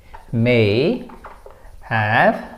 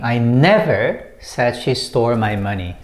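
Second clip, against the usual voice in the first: "never" punched with a pitch swing, the rest lower; stress on NEVER; 3 semitones lower than usual. Shown as a demonstration. Teacher explaining emphasis on the second word, stress on "never". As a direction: hit the word "never" hard. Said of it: "when you put emphasis on the second word".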